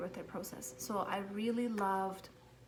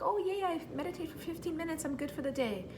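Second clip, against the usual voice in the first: high, fast mock cheer; mock self-satisfied; 9 semitones higher, faster than usual. Mom mocking her own smugness after meditating, fast high cheer. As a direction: play up naive smugness, pitched up. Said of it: "I was like".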